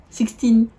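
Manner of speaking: the product name read in English with a heavy French accent. Real, not acted. Beauty vlogger reading the English product name, French-accented English. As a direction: sixteen hours in plain French-accented English. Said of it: "Ah, mon accent, ça fait pitié".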